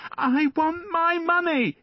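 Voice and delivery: put-on high, slow, insistent boy's demand; shaking the man and demanding his coin; child crying out. A narrator's reading. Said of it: "he cried".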